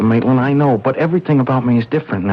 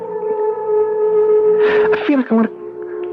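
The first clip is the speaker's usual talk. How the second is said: wondering; much slower than usual. Acted. Bewildered by new feelings on waking.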